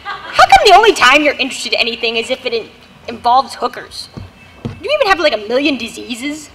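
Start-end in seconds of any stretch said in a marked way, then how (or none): 1.2-6.5 disgusted mutter. Acted. Wife's disgusted accusation.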